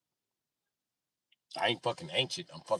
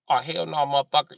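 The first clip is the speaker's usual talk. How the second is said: loud, higher-pitched mock outrage; mock outraged heckler; 10 semitones higher, 16 dB louder than usual. Imagined heckler told to sit like a dog, mock outraged. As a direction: refuse loudly, playing the offended man. Acted heckler refuses the command.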